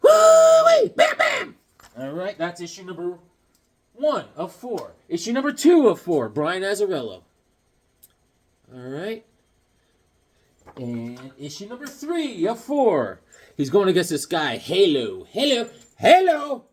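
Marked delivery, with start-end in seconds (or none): 0.0-1.6 very high, loud whoop. Real, not acted. Fan cheering over a comic he loves, high whoop. 14.6-16.7 rising repetition. Pretend. Naming the villain Halo, rising higher each time.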